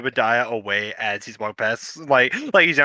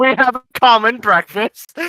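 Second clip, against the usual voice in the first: character voice correction; 8 semitones higher, 4 dB louder than usual. Acted. Correcting English to common in voice.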